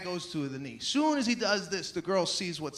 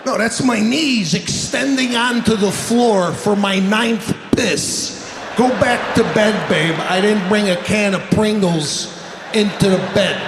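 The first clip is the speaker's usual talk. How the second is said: full-volume ranting delivery; loud comic rant; 11 dB louder than usual. Real, not acted. Comic rants to the crowd about bad sleep, loud. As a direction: blast it at the crowd.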